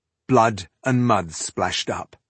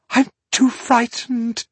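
frightened; 13 semitones higher, 4 dB louder, slower than usual. Acted. Panicked Maga pleading.

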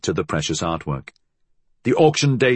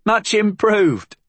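high, slow amused reply; light laugh through the words; 12 semitones higher, 3 dB louder, slower than usual. A narrator's reading. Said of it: "Molly laughed".

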